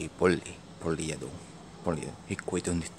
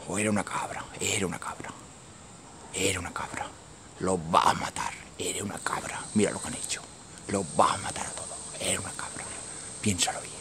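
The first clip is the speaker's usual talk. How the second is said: soft unvoiced whisper; hushed whisper; 4 semitones lower, 8 dB quieter, faster than usual. Real, not acted. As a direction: breathe it out in awe. Hushed awe at the scene.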